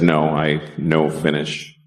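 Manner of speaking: politely mirror her broken English; mimicking her accent. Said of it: "repeat people's bad English to them".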